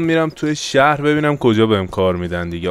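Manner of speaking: usual speaking voice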